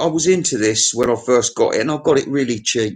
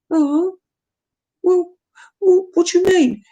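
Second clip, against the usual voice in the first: much higher, small cartoon voice; squeaky sidekick voice. Socrates interrupting the host, much higher squeaky voice. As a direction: pipe up like a tiny puppet.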